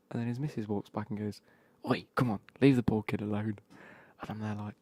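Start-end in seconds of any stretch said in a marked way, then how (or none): 1.9-3.6 loud, imitated scolding partner; retold telling-off. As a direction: call him off loudly, acting exasperated. Missus telling off her drunk partner.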